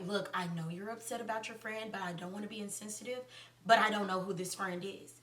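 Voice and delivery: hushed, low, careful voice; quietly retold delicate admission; imitated tactful confiding. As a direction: break it gently, very softly. Survivor tactfully breaking it to her.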